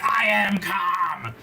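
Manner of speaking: loud, high, stretched-out imitation; hammed-up furious holler; mock angry scream. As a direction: yell it slowly like a woman who is anything but calm. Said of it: "She's like".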